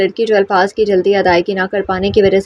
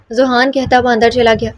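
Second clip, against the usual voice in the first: ordinary delivery, nothing marked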